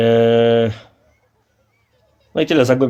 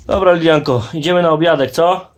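calling out; 6 semitones higher, 4 dB louder than usual. Real, not acted. Calling daughter to lunch.

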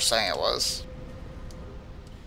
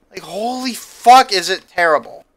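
much higher, louder, slow drawn-out outburst; exploding in frustration; 5 semitones higher, 6 dB louder than usual. Real, not acted. Player vents at how terrible the game is, drawn-out angry outburst. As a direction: stretch every word in exasperation. Peak of rant.

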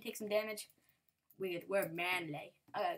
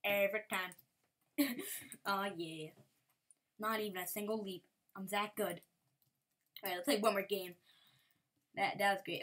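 ordinary delivery

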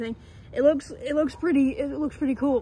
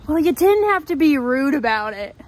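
mock offended; 8 dB louder than usual.

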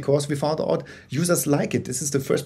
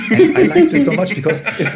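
real laugh spilling into the words, much higher and louder; 11 semitones higher, 8 dB louder than usual. Real, not acted. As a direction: laugh it out, delighted by the image. Laughing at friend's ugly quote.

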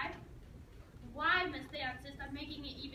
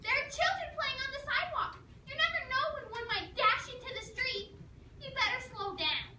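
much higher, louder nagging mother; nagging mom voice; 10 semitones higher, 4 dB louder than usual. Retold or imitated. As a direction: imitate mom's drilling lecture voice. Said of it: "she said this in her mom voice".

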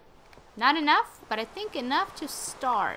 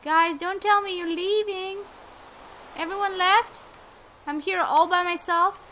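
high-pitched, louder protest; dismayed disbelief; 9 semitones higher, 5 dB louder than usual. Real, not acted. Player calling to players who left her, dismayed disbelief. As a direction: call out in dismay at being deserted.

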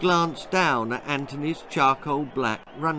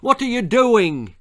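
high, loud demand; bawling; 10 semitones higher, 8 dB louder than usual. A narrator's reading. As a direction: shout in disbelief. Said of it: "he bawled".

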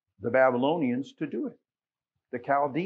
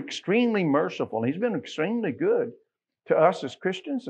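rising gospel fervor; fervent preaching; 4 semitones higher than usual. Acted. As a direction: lift the voice in praise of a merciful God. Preaching at full force.

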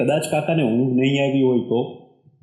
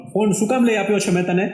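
raised voice; 6 semitones higher than usual. Acted. Akash scolds Manasvi, voice rising higher. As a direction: voice rises, pitched higher in frustration. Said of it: "છેલ્લું વાક્ય બોલતા આકાશનો અવાજ ઊંચો થઈ ગયો".

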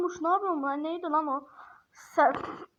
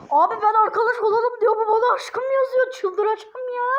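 much higher and louder, laughing in disbelief; exasperated laughing outcry; 7 semitones higher, 5 dB louder than usual. Real, not acted. Complaining to viewers that the chatbot wrote my love, laughing outrage. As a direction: yelp it out half laughing, half outraged.

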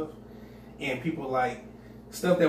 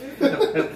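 high burst of laughter; 14 semitones higher, 7 dB louder than usual. Real, not acted.

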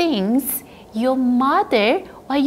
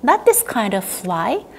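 normal talking voice

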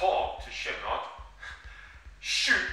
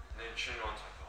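ordinary delivery

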